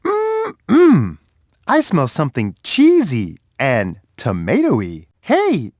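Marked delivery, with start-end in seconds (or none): 0.1-1.2 high, pleased mm-mm hum; savoring hum. Acted. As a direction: hum with delight at the smell. Savoring a food smell.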